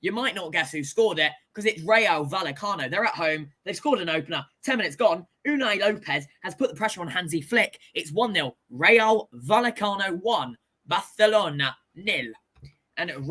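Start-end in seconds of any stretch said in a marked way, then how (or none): none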